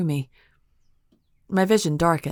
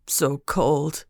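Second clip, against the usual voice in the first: deep crying voice. A narrator's reading. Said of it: "a deep voice cried".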